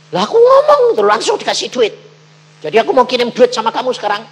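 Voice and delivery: high, loud, fast rush of news; excited caller. Retold or imitated. Landowner phoning the preacher, excited fast gushing. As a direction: gush it out in a hurry.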